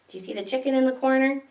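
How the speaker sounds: ordinary delivery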